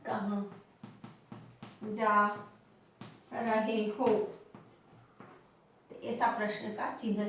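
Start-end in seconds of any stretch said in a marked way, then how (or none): 0.1-4.1 lower, word-by-word with long pauses; slow dictation. Shown as a demonstration. Teacher writes out the question, dictated slowly. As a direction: dictate कहाँ, जा, रहे हो separately. Dictating the example while writing.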